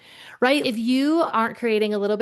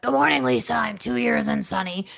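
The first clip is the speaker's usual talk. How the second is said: growly voice. Retold or imitated. Older coworker greeting his leader each morning, growly. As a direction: put on a growly old coworker voice. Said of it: "in this growly voice".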